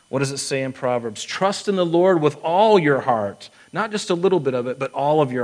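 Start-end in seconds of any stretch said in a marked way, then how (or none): 1.2-3.5 slow, louder, each word weighed; quoting scripture slow and loud; emphatic scripture quote. Shown as a demonstration. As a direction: lean on every word of the verse. Preacher quotes Proverbs emphatically.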